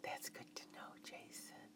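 soft, fully whispered words. A narrator's reading. Woman whispers into his right ear, hushed whisper. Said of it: "as I whispered into his right ear".

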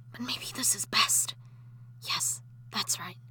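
soft breathy whisper to herself, calming down; hushed whisper. Acted. Ghost wife whispering beside her sleeping husband. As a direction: keep it whispered, don't wake him. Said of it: "I'll wake him".

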